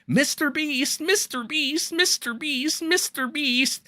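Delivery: high whiny mock plea, repeated; mock crying plea. Mocking a crying streamer begging Mister Beast, high whine. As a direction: imitate someone whining for help. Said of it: "before he started crying".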